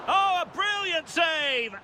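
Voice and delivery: high, drawn-out exclamation of admiration; excited save call. Real, not acted. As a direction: marvel at the keeper's save, pitched high and slow. Commentator reacts to a save.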